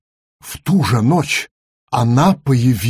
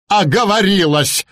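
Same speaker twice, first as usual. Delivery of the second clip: grumbling; 6 semitones higher than usual. Acted. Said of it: "проворчал Ворон".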